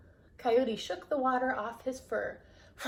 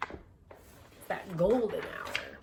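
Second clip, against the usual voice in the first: ordinary delivery, nothing marked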